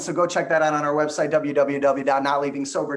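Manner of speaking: fast, high, loud auctioneer patter. Retold or imitated. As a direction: rattle it off like a county auctioneer. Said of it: "he talks like a Gundy County auctioneer".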